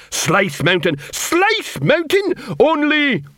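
rising, high-pitched mocking echo; scornful troll sergeant. Troll sergeant echoing the recruit's answer, scornful.

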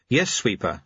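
ordinary delivery, nothing marked

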